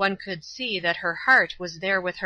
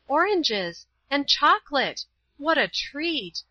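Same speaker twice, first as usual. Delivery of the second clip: higher, delighted exclaiming over each gift; 7 semitones higher, slower than usual. A narrator's reading. Teacher opening a gift package, higher delighted exclaiming. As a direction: pitch up with joy at every treat. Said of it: "as she opened the packages with delight".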